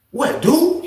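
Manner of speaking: surprised. Retold or imitated. Shocked at friend's news.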